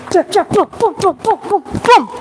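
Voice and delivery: high, staccato booms for each punch; voiced punch combo. Shown as a demonstration. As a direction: sound out a fast flurry of punches. Calling out punches in a demo.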